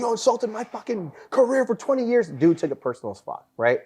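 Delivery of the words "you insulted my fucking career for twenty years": mock angry sergeant. Retells sergeant's angry rebuke.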